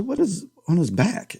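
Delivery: surprised inner question; puzzled. Retold or imitated. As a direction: ask it like you just spotted something odd. Puzzled thought first viewing.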